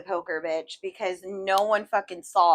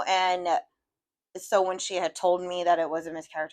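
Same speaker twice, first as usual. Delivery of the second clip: normal talking voice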